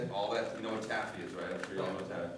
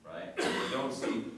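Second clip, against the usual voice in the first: ordinary talk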